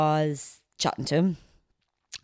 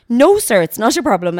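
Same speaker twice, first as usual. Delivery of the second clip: eager compliance; 6 semitones higher, 8 dB louder than usual. Retold or imitated. Staffer agreeing, voiced in story.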